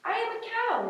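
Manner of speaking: high, sweet little cow voice. Acted. Cow answering the elephants in a high little voice. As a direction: squeak it out like a timid cow.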